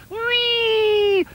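high, long sliding squeal; sliding whee. Acted. Duck going down the beanstalk slide, squealing.

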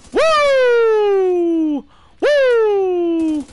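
loud, high, held "woo"; two long triumphant high howls; long whoop. Real, not acted. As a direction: whoop like a siren. Whooping during game kills.

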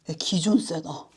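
muttered aside. Pretend. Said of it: "유식님 그냥".

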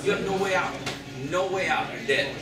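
ordinary talk

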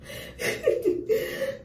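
high, breathy burst of laughter; breathless laugh. Real, not acted. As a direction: laugh at the memory, almost silent. Laughs recalling cutting hair.

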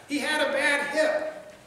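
ordinary delivery

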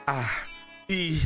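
usual speaking voice

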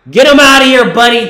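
loud, high jeering shout; taunting shout. Real, not acted. Heckling a player at the TV, loud jeering yell. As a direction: yell him off the court.

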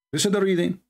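ordinary talk

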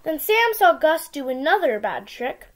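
ordinary delivery

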